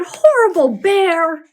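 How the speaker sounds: loud, high cry of fury; angry yell. Acted. Girl yelling at the bear over her broken kite. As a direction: shout it at the bear, furious. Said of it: "She yelled".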